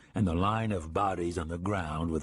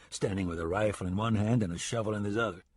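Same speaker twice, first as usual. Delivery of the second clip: plain reading voice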